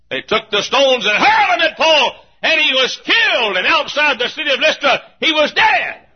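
high, loud, ringing pulpit proclamation; preaching at full force. Acted. Preacher to his congregation, proclaiming at full force. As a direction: build each line to a shouted peak.

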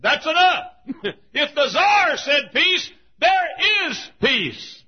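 high, slow triumphant shout; ringing shouts. Acted. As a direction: proclaim it to the whole army at full voice. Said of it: "shouts rang forth".

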